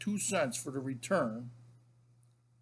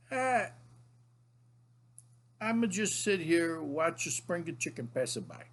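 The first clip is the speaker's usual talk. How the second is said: higher-pitched imitation of an old-country grandfather; grandpa impression; 4 semitones higher than usual. Grandfather tells grandson what he's doing, imitated grandpa voice. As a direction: put on grandpa's voice, starting high and easing down. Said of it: "he would say to me".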